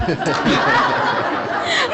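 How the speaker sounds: embarrassed high laugh; nervous laugh. Real, not acted. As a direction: laugh it off awkwardly. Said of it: "I'm so sorry".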